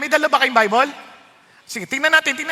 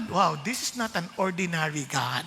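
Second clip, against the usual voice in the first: much softer and lower, in awe; hushed awe; 8 semitones lower, 11 dB quieter than usual. Acted. Preacher hushing the church to feel God's power, quiet awe. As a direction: drop to an awed hush. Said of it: "drops to hushed awe".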